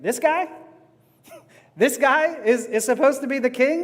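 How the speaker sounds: high, incredulous scoff; mock the idea he is king; scoffing Pilate. Preacher voicing Pilate's scornful disbelief, scoffing. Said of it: "That's how Pilate thinks of Jesus".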